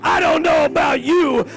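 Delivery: high, loud preacher's cry; full-force preaching. Acted. Preacher crying out to the church about his hopes. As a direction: preach it out, building each line. Preaching climax, louder higher.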